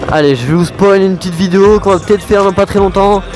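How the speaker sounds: talking loud inside a helmet while riding. Real, not acted. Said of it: "on se retrouve tout de suite dans le casque".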